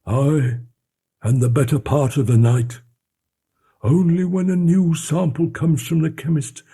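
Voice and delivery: anxious butler urging his master to listen; uneasy old butler. Acted. Poole voiced, tense, uneasy.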